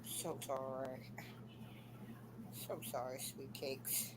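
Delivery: soft, low hushed whisper; whispered apology. Real, not acted. Whispering sorry after waking her boyfriend, real hush. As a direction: breathe the sorry so nobody wakes. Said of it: "We woke him up".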